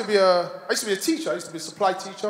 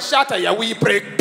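slow, put-on Scottish telling-off; imitated Glaswegian Scottish rebuke; Scottish accent; 3 dB louder, slower than usual. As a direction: scold the heckler in a Scottish accent. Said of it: "The guy behind him bottled him".

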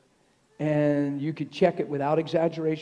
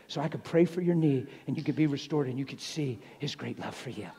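ordinary delivery

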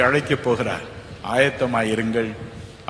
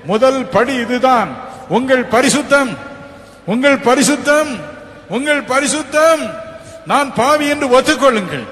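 preach; 9 semitones higher, 5 dB louder than usual. Acted. Preaching at full force.

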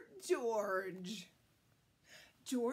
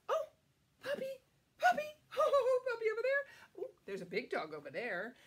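plain reading voice

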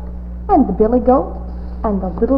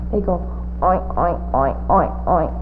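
low grunting oink, oink; playful pig noises; 4 semitones lower, 3 dB quieter than usual. Shown as a demonstration. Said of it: "Can you make it right along with me?".

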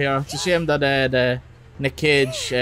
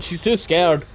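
ordinary talk, nothing marked

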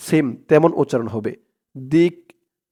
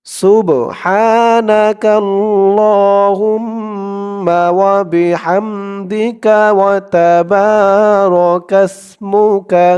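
very slow, higher, stretched Arabic recitation; drawn out recitation; 3 semitones higher, much slower than usual. Shown as a demonstration. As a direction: draw every syllable out long. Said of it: "আমি একবার টেনে টেনে বলবো". (টেনে টেনে ধীরে তিলাওয়াত)

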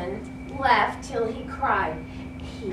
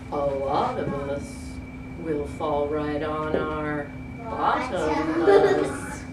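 hippo voice; 9 semitones lower, 4 dB quieter than usual. Acted. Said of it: "The hippopotamus said".

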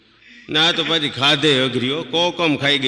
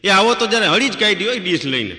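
high, loud calling out across the room, half laughing; mock yell; 7 semitones higher, 3 dB louder than usual. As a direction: friend hollering to the wife, amused. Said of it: "રાડ પાડી કે". (ઊંચા સાદે હસતી બૂમ)

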